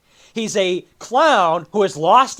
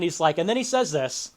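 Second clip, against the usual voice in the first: usual speaking voice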